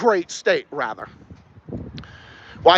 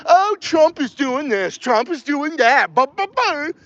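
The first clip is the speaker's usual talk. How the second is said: slow, higher whiny mock of a politician complaining; mock complaint, slow and higher; mocking Maura Healey; 4 semitones higher, slower than usual. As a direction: parody her griping, drawn out. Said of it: "when Maura Healey goes after Trump".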